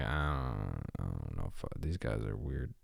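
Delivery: quiet muttering. Retold or imitated. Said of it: "I'm like".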